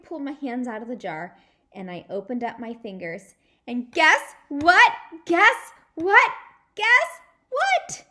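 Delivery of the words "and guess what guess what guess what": building excitement. A narrator's reading.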